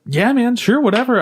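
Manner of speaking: high, breezy mock shrug; imitated casual acceptance. People shrugging at dating an AI, casual and high. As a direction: wave it off in a lifted voice. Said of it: "like, super casual".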